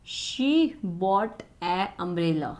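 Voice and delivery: very slow, word by word; slow dictation reading. Shown as a demonstration. Teacher reads the next error sentence to students, very slow. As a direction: read the sentence out at a crawl. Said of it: "next है".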